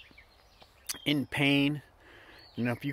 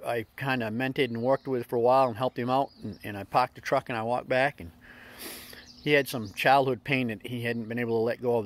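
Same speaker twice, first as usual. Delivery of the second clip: usual speaking voice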